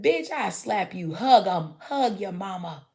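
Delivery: loud, slow, mock-threatening scold; hammed-up stern mama, loud and drawn out; mock tough-love scold. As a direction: bark it like a fed-up mama, slowly. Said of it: "And I'd be like".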